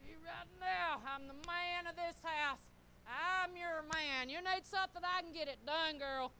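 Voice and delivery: loud, high, boastful husband act; puffed-up husband. Acted. Praised husband boasting to his wife, playfully cocky. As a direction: play a husband swelling with pride. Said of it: "you know what happens to him".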